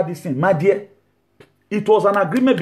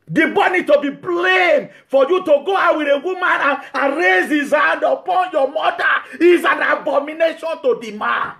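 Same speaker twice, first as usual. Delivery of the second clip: indignant preaching shout; 8 semitones higher than usual. Real, not acted. Preacher scolding a husband who let his wife beat his mother, raised shout. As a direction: hammer the blame home.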